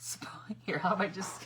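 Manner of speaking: ordinary talk, nothing marked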